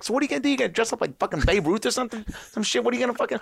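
high mocking Bill Burr voice; imitating Bill Burr. Mocking Bill Burr to the podcast host, high. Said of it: "And Burr's like".

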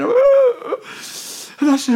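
drawn-out high wail, acted; mock crying. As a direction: break into a put-on high sob. Acts out his crying, wailing.

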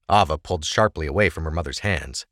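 ordinary delivery, nothing marked

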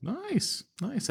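high, soft, squeaked "Nice"; playful high-pitched approval; squeaky mock "nice". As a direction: coo it in a tiny high voice. Mock impressed reaction.

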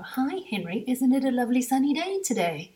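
put-on high, loud sing-song question to a child; mock chirpy British questioner. Adult greeting Henry with a question tone, mock chirpy and high. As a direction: ham up a cheery adult quizzing a child. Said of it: "figured out the tone of a question".

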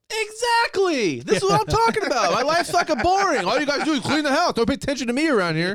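host mocking kids who watched the ad; mock whiny kid. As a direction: whine like a neglected child. Kids mimicked complaining at parents.

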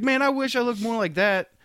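longing for that body; wistful. Retold or imitated. Envious quote, raised pitch.

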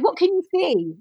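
high, loud, pointed "What can you see?"; leading question. Retold or imitated. Wife prompting her unseeing husband.

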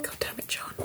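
hushed, breathless curse; exasperated whisper. Real, not acted. Player cursing John in a whisper. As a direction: mutter it under your breath.

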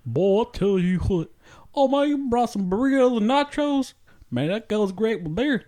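high, hearty Texan drawl; Texan accent. Acted. Actor hosting his friend in a Texas accent. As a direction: play a loud cowboy host. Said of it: "Mexicans from Texas that talk like that".

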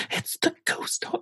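gasp, then breathless hush; awestruck whisper. Retold or imitated. Girl finally sees the ghost horse, awestruck whisper. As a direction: imitate her whispering in wonder.

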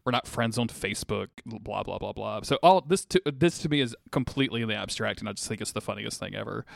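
normal talking voice

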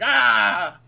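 loud, high villain shriek of frustration; hammed-up scream of a defeated villain; Rita Repulsa scream. Host imitating an upset villain at episode's end, frustrated scream. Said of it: "It's like she's Rita Repulsa".

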